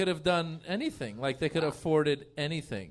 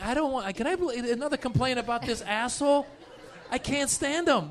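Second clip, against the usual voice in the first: mock whiny complaint, raised high; a fed-up worker griping to HR, pitched up; complaining staffer; 7 semitones higher than usual. Coworker griping to HR about the boss, mock whiny. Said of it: "going into H R going like".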